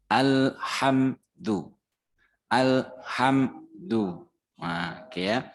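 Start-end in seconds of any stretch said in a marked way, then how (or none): 0.0-4.3 Alhamdu drawn out slowly; slow model reading of Alhamdu. Shown as a demonstration. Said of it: "Sekarang sudah alhamdu".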